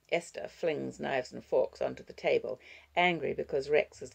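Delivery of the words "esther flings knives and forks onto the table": ordinary delivery, nothing marked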